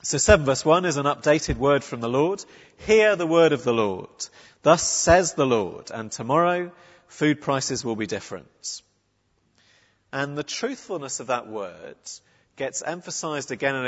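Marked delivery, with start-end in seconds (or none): 2.8-8.8 prophetic proclamation. Retold or imitated. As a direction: announce it like a prophet to the city.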